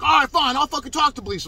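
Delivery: high, loud, belligerent concession; imitated defiance. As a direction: mimic him snapping back defiantly. Acted quote of defiant aggressor.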